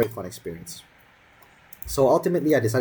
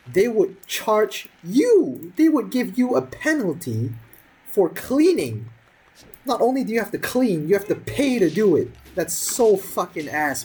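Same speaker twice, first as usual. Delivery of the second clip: indignant rant; 9 semitones higher than usual. Real, not acted. Ranting about paying to clean.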